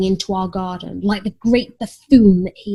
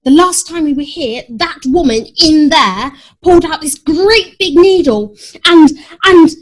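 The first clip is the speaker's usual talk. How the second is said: anxious rising panic; 6 semitones higher, 6 dB louder than usual. A narrator's reading. Cat frightened about vet.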